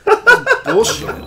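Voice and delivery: laughing. Real, not acted. Laughing complaint after falling.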